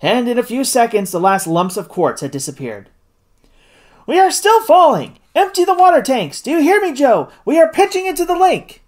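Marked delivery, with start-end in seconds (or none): 4.1-8.8 high, urgent orders in an emergency; alarmed, high-pitched commands as they fall. A narrator's reading. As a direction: call out frantically to the crew. Captain shouting orders as balloon falls.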